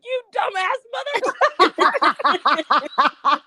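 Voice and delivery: high, drawn-out self-insult with a laugh; half-laughing at her own mistake; mock self-scolding. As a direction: curse yourself out in mock outrage. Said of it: "I was like".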